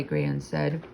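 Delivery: plain reading voice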